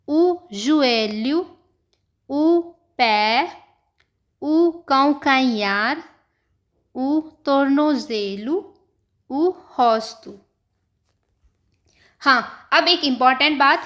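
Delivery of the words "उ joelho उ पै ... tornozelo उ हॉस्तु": each word drawn out very slowly, one at a time; slow repetition. Shown as a demonstration. Teacher repeating the vocabulary list very slowly for students. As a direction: recite the list at dictation pace. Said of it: "मैं फिर से repeat कर रही हूँ".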